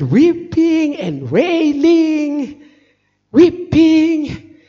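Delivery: high, stretched-out mock wail; drawn-out wailing. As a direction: draw the words out like a lament. Acting out her weeping and wailing.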